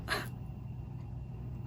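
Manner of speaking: shaky breathless sigh before tears; a whispered, tearful sigh; choked sigh. Acted. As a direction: sigh as if fighting back tears. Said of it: "I get choked up".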